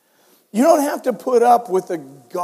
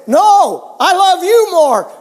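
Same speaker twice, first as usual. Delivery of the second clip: higher and louder, topping the grandson; louder playful insistence; 10 semitones higher, 8 dB louder, slower than usual. Retold or imitated. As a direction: raise the voice to win the loving game. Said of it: "The louder you said it".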